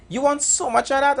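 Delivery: high-pitched mimicked server's question; quoted food server. Servers question the plenty pepper order, high mimicked voice.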